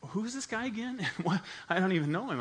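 high-pitched baffled retort. Retold or imitated. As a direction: retell it in disbelief. Retelling his baffled reaction to accusation.

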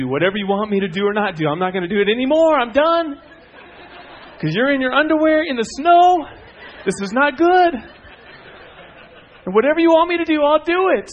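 high, loud pleading, building to a squeal; submissive dog's voice. Acted. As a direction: comically imitate a dog surrendering completely. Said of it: "she wanted me to know something".

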